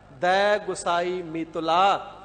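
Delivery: ਦਇ ਗੁਸਾਈ ਮੀਤੁਲਾ recited slowly, ਦਇ as "dai"; correct recitation demo. Shown as a demonstration. Preacher models the correct verse for listeners, slow careful recitation. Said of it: "ਦਇ ਪੜ੍ਹਨਾ ਹੈ".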